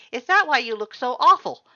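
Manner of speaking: high and loud with worry; loud, concerned. A narrator's reading. Skeleton asking a tired friend, loud and worried. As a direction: call out over her face, alarmed. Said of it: "his loud voice filled with concern".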